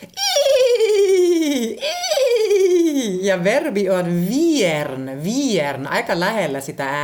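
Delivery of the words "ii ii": "ii, ii" squealed very high and loud with a laugh; whinny like a horse, laughing; giggly high neigh; horse whinny imitation. Shown as a demonstration. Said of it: "ne tekevät ii".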